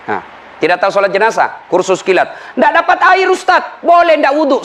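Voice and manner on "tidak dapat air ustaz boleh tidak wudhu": mock questioner. Congregant asks ustaz a question.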